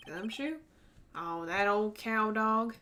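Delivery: slow lazy Southern drawl; Tennessee accent. Acted. Marshall mocking Gumshoe in a slow Tennessee drawl. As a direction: stretch it out like a cowboy. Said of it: "I get to do that accent".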